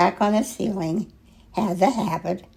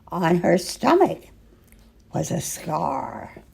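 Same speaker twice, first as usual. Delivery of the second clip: usual speaking voice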